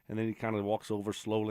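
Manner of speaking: ordinary talk, nothing marked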